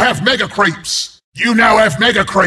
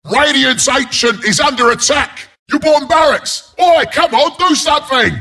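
high, urgent announcer call; urgent alarm; 5 semitones higher than usual. Acted. Announcer warning barracks are under attack, urgent alarm. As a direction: sound the alarm to the team.

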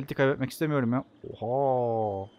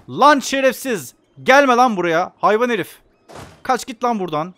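angry shouting; 6 semitones higher, 7 dB louder than usual. Real, not acted. Yelling at the AI country.

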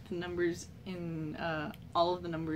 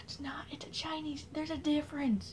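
whisper; 8 semitones higher, 5 dB quieter than usual. Acted. Whispered correction.

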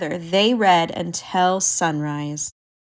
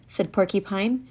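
plain reading voice

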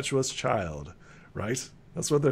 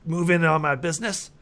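high-pitched, accusing crank; not-quite-sane alchemist; 5 semitones higher than usual. Acted. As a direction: accuse like a paranoid, unhinged shopkeeper. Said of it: "alchemist who's not quite sane".

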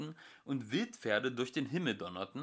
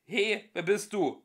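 high, loud quacking penguin; 8 semitones higher, 7 dB louder than usual. Acted. Ford as penguin quacking at the voice. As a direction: quack it out angrily. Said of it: "quakte er".

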